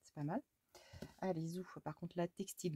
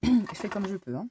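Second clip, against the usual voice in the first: exasperated; 2 semitones higher, 12 dB louder than usual. Real, not acted. Frustrated with crafting mess.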